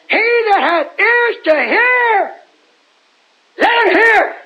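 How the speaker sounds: high, slow, drawn-out proclamation. Acted. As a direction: cry it out high, stretching each phrase. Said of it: "He raised his voice".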